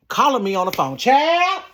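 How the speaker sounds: high loud exclamation; exclaiming. Real, not acted. Exclaiming about callers.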